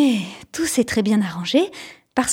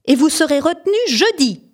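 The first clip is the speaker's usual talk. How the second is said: higher, firm punishment; teacher punishes Rufus, raised and stern; 6 semitones higher, 4 dB louder than usual. A narrator's reading. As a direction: hand out detention with raised voice. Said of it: "a crié la maîtresse".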